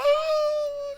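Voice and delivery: high cry imitating the weeping girl; mock sobbing cry. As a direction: let out a sudden high wail. Moça chorando na pregação. (grito agudo imitando a moça)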